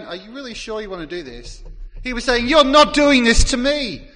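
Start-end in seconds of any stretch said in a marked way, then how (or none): 0.0-1.6 quick, soft, hesitant query; tentative question. Retold or imitated. Peter doubting Jesus, a quick soft hesitant question. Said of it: "It wasn't that he was saying". 2.5-4.1 louder, higher flat refusal; emphatic refusal. Retold or imitated. Peter refusing Jesus washing his feet, loud emphatic refusal. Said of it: "It was emphatic".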